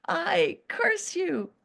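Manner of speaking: mock the cruel master through laughter; mock curse laughing. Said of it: "And he started laughing".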